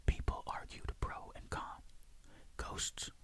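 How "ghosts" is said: ordinary delivery, nothing marked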